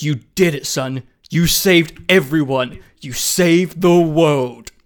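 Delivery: lightly voiced president, slow and grand; a hint of a solemn president, drawn out; mock president. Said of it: "the president is in my ear and he goes".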